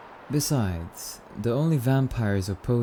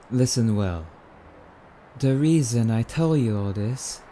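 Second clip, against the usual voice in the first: ordinary delivery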